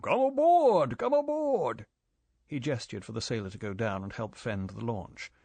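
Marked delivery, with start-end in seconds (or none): 0.0-1.9 loud, high, cheerful welcome shout; ship's owner calling the visitors aboard, loud and cheerful. A narrator's reading. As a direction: boom a hearty welcome down to the boat. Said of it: "He called down cheerfully".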